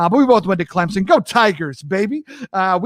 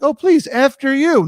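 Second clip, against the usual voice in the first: higher, overdone courtesy; exaggerated after-you graciousness; mock politeness; 7 semitones higher than usual. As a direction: politely insist they go first. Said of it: "It sounds like it's very polite".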